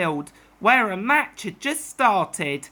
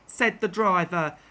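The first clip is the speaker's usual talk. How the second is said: ordinary delivery, nothing marked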